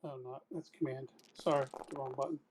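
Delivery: normal talking voice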